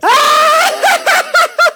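high, loud burst of laughter. Real, not acted. Host reacting to co-host's made-up fact, loud laugh.

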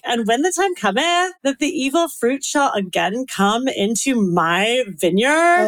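put-on Valley girl accent ending like a question; Valley girl uptalk. Shown as a demonstration. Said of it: "do everything with a question at the end".

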